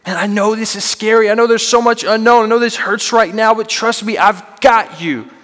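put-on comforting a scared child; God reassuring. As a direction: hold them close with your voice. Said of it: "what God so often wants to say to us".

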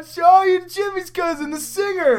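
high, loud excited exclamation; excited movie quote. Retold or imitated. Quoting the Superbad scene, high excited shout. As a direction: shout it like the overjoyed character.

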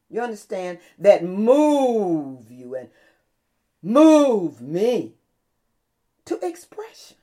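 loud, slow, stretched pulpit cries; impassioned sermon climax; full-force preaching. Real, not acted. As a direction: preach it hard, drawing out the big words.

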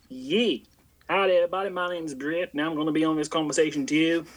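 mock Southern US greeting, playing a friend; Brit. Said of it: "can I be the voice of Brit".

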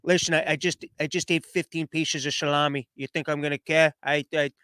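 a mob boss too stuffed with salami to care; mobster voice. Retold or imitated. Stuffed mob boss waving off a blunder, in a low mobster voice. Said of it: "I don't know why I'm mixing in Trump with him".

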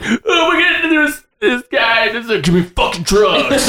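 high mock voice of a patient faking it; mock Laurie. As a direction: ham up a breezy act for drugs. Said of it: "she's like".

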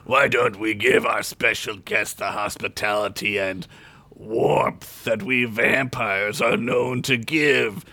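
slow, smooth, sweet vampire voice; honeyed dark lord. Acted. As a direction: pour it out like honey. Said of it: "as if honey itself were pouring".

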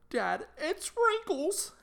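high, slow, loud mock alarm; imitated startled daughter. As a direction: put on a kid's drawn-out, high-pitched shock. Voicing startled daughter seeing the reply.

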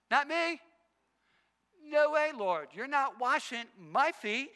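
loud, high protest with the last words drawn out; indignant Peter. Acted. Peter objecting as Jesus comes to wash his feet, indignant. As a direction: refuse loudly, pitched well up. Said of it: "What did Peter say when Jesus came to him?".

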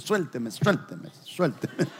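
slow, hushed mock soap-opera plea, breaking into a laugh; telenovela melodrama. Husband shakes off his embracing wife, slow hammed-up telenovela plea. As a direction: ham it up like a telenovela husband pushing her away. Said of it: "Ya usted ha visto esas novelas".

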